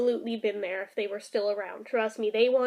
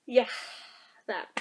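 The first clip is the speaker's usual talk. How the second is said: ordinary talk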